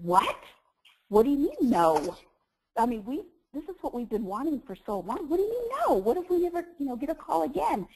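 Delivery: retold pleading disbelief at her husband; disbelieving protest. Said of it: "And I said".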